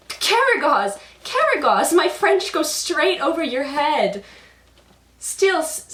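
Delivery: high, loud exasperated cry; exasperated Hasavat. Acted. Hasavat despairing that his French is lost on Karagos, loud and high. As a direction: shout his name in despair. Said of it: "a whole confusion".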